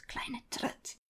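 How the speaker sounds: hissed under the breath; whispered insult. Acted. Grandmother cursing the baby under her breath, whispered. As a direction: spit it out so nobody hears.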